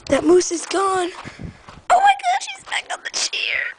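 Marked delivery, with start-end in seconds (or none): 1.9-3.8 very high, thin shriek of alarm; hammed-up falsetto fright; shocked squeal. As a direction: squeal in mock horror. Moose reappears on chair.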